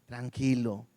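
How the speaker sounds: low, soft soothing word; calming reassurance. Acted. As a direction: drop right down to settle a worrier. Soothes the imagined worried believer.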